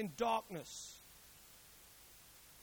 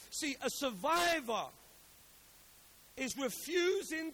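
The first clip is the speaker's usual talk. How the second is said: forceful, stretched key words with pauses; rally the congregation to repeat it; emphatic preaching; 5 semitones higher, 6 dB louder than usual. Acted. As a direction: drive home each phrase from the pulpit.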